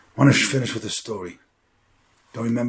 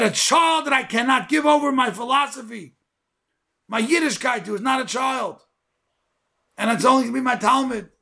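preaching at full force; 13 semitones higher than usual. Acted. Rabbi driving home Avraham's words to the congregation, fiery preaching. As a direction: thunder out the lesson to the crowd. Climax of sermon, shouting.

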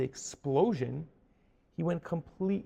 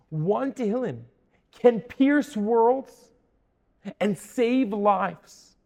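loud, higher-pitched, rhythmic preaching; impassioned preaching; 5 semitones higher, 8 dB louder, slower than usual. Real, not acted. Preacher to congregation on prayer's power, impassioned preaching. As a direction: build phrase by phrase, stretching the key words.

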